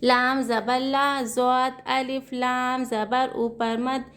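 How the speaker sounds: slow, drawn-out sing-song letter spelling; slow spelling drill. Shown as a demonstration. Teacher spelling out Quranic words for students, slow sing-song chant. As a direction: chant each letter and vowel, then the joined syllables.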